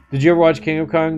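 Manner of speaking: normal talking voice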